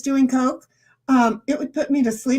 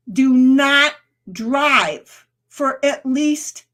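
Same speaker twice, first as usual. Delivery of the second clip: each word slow and a bit louder; slow warning; 4 dB louder, much slower than usual. Real, not acted. Serious warning about a drug.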